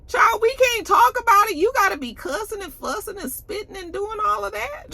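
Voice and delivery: high-pitched incredulous scolding; mock-appalled telling-off; exasperated disbelief. Mock reaction to cussing at table.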